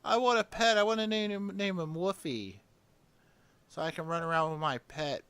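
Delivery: put-on high, childish whiny pleading; mocking whiny gamer. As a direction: whine like a kid begging. Mocking gamers wanting pets.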